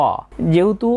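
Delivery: ordinary delivery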